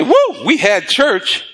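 imitated after-service whoop; mock churchgoer's elation. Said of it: "I don't go somewhere and say".

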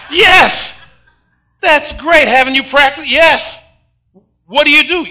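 high, loud, laughing encouragement; laughing excitement. Retold or imitated. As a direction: cheer the boy on through laughter. Grandpa keeps cheering grandson on.

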